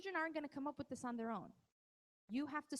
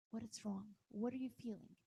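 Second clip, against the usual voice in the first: softer, gentle asking; calm voice; 3 semitones lower, 4 dB quieter than usual. Shown as a demonstration. Parent gently asking an upset child, calm and soft. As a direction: ask kindly what they feel. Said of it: "in a calm voice and say".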